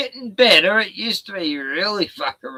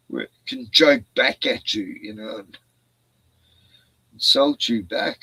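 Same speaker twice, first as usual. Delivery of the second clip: ordinary talk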